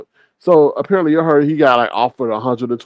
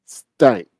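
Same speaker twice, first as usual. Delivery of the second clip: ordinary talk, nothing marked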